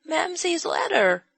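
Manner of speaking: put-on high, slow child's voice; a small child holding up her treasure; little girl voice. A narrator's reading. Child speaking in story.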